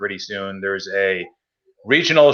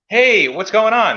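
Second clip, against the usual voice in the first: higher, slow cheery hey on the phone; bright phone greeting; 10 semitones higher, 4 dB louder, slower than usual. Retold or imitated. Calling the shop owner again, drawn-out cheery greeting.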